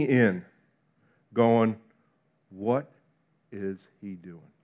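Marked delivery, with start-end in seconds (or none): 2.5-4.5 much softer and lower, puzzled; puzzled hush. Retold or imitated. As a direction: lean in and wonder under your breath. Crowd member leaning in puzzled.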